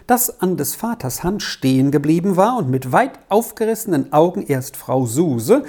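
ordinary delivery, nothing marked

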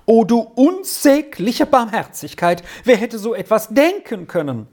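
high-pitched cry of happy disbelief; amazed exclamation. A narrator's reading. Trine overwhelmed by the offer, exclaiming in amazement.

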